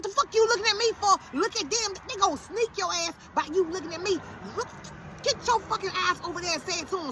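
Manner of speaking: high-pitched, frantic mother's telling-off; exasperated scolding. Retold or imitated. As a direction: order your son back into it. Acts out scolding son mid-fight.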